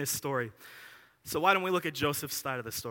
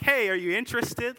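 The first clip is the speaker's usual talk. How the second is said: polite offer; 7 semitones higher, 4 dB louder than usual. Retold or imitated.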